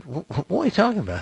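high, fast stammer of disbelief. Retold or imitated. Narrator retelling his shock at the boss, stammering fast and high. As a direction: sputter it out, caught off guard.

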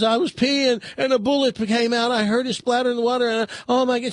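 lightly voiced distressed daughter; upset daughter. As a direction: gush it out in a high, frantic rush. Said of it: "bursts into tears".

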